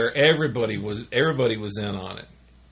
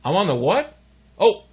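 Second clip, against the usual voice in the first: louder, rising "what" then a deflated "oh"; startled realisation; 8 semitones higher, 7 dB louder than usual. Retold or imitated. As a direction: catch yourself live on the intercom. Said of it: "And then you hear".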